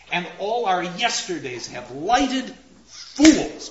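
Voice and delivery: "And all our yesterdays have lighted fools" slow and loud, landing hard on "fools"; booming verse stress. Shown as a demonstration. Lecturer shows the metre's effect, loud slow stresses. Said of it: "but boom, there it is".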